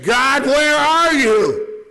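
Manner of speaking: very high, very slow desperate scream to God; screamed through tears. Retold or imitated. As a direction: cry out to God, breaking. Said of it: "I screamed through my tears".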